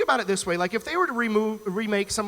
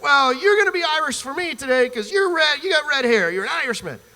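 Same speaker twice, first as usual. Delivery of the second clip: lightly voiced pushy bar stranger, pitched up; insistent stranger; 6 semitones higher, 4 dB louder than usual. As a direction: start high, settle as you insist.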